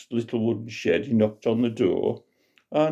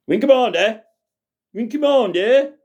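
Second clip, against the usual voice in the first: loud, much higher panicked shouting; 13 semitones higher, 5 dB louder than usual. Acted. Grandad shouting at the dead pilot, loud and high. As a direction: yell to wake the slumped pilot. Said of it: "and shouted at him".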